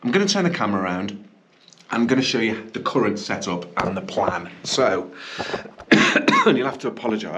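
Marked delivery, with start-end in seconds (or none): 5.2-5.9 a short dry cough mid-sentence; real cough. Real, not acted. Vlogger apologising to viewers for a cough, coughing. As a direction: clear the chest with a quick cough. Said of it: "I got a bit of a cough".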